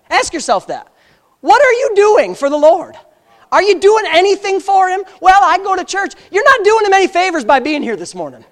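forceful pulpit challenge; bold preaching. Real, not acted. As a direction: confront the congregation boldly, pitch up. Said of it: "can I just really get bold here?".

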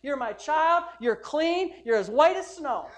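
loud proclaiming voice of God, with a laugh at the end; God's loud affirmation. Retold or imitated. Pastor voicing God calling him righteous, loud joyful proclamation. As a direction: declare it like God pronouncing him clean. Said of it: "And he says".

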